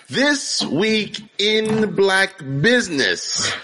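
podcast host drawing out the segment name; segment announcer. Pretend. Introduces the show segment.

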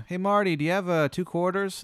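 high, casual guard voice. Acted. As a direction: ask a buddy for coins. Guard asks for change.

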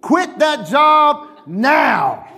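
slow, high, deliberate command to quit; firm order. Retold or imitated. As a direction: lay down the law word by word. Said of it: "I said".

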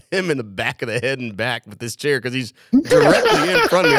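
ordinary delivery

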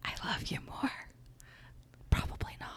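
soft teasing whisper. Pretend. Cohost whispers back a playful love reply. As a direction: whisper it back, then deadpan.

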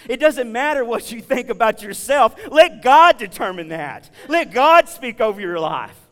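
rising to a loud, full-force preaching peak; preaching climax. Real, not acted. Preacher urging the congregation to let God speak, preaching loud. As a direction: drive it home with everything you've got.

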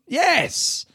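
high-pitched, emphatic burst of approval; sudden high cry of delight; exultant cheer. Real, not acted. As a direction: cheer the idea on. Cheering the idea he describes.

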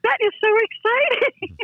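high-pitched hammed-up delight; gushing. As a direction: gush like a starstruck fan. Retold gushing reply to friend.

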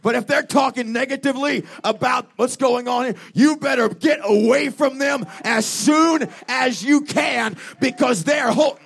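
fired-up revival preacher; full-force preaching. Real, not acted. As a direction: preach it loud to the whole congregation, drawing out key warnings.

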